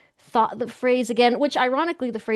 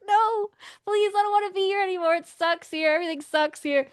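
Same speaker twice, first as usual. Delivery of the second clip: complaining; 9 semitones higher than usual. Retold or imitated. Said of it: "me complaining to aliens".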